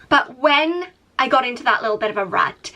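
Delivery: usual speaking voice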